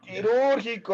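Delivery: quirúrgico high and punched, stress on the ú. Shown as a demonstration. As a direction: lean hard on the second syllable of quirúrgico. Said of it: "acento en la U". (quirúrgico con acento fuerte en la ú)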